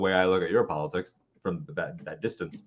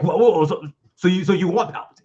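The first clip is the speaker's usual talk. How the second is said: higher, louder, mock-outraged voice; mocking indignant fan; 7 semitones higher, 5 dB louder, faster than usual. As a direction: play a shocked gatekeeper, whoa whoa. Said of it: "that's not the time to be like".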